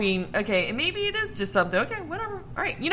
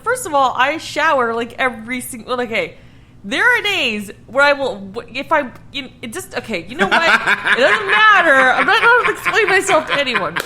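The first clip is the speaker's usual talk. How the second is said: getting louder and higher, ending in laughter; defensive; 3 semitones higher, 7 dB louder than usual. Real, not acted. Defending her showering habits to her co-host, louder and laughing. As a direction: protest your own hygiene, half laughing. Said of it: "So defensive".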